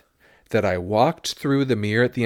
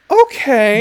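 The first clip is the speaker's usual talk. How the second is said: excited gasp; 13 semitones higher, 11 dB louder than usual. Real, not acted. Excited reaction to result.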